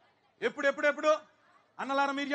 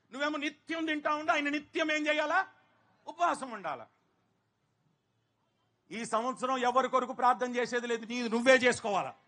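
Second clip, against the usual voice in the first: normal talking voice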